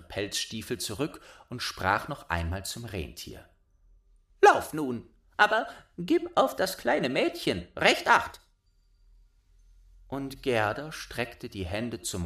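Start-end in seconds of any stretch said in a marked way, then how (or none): 4.4-8.4 put-on much higher, loud child voice; bossy little robber girl. A narrator's reading. Robber girl claiming Gerda, high bossy child voice. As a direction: demand it like a spoiled robber girl.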